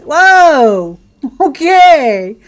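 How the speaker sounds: loud, high startled whoa into a laugh. Real, not acted. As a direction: caught off guard, loud and laughing. Startled by a jump in game.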